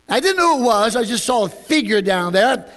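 ordinary talk, nothing marked

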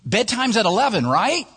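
put-on high, breezy babysitter question; clueless checking.

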